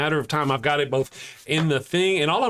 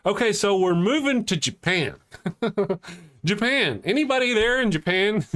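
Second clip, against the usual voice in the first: excited call; 7 semitones higher than usual. Real, not acted. Calling out to viewers in Japan, excited and higher.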